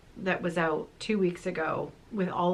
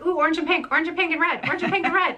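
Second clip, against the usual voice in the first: high, loud gushing imitation; 9 semitones higher, 5 dB louder, much faster than usual. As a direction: chant the colours with delight. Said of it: "Carrie's like".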